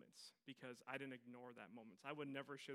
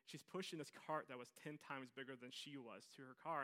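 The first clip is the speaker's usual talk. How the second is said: ordinary talk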